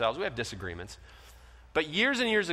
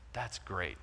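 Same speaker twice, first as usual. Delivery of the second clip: ordinary delivery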